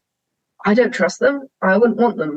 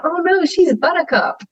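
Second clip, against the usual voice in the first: much higher, cooing over a pet cow; doting cow talk; 10 semitones higher than usual. Retold or imitated. To her boyfriend, pleading for her cow in a much higher doting voice. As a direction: coo it like she's your baby.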